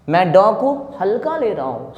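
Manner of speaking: ordinary delivery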